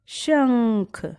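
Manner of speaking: शंख very slowly, nasal held long; nasal demo word. Shown as a demonstration. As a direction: draw out शंख through the nose. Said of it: "आप बोल के भी देखिए जरा". (शंख को बहुत धीरे, नाक से)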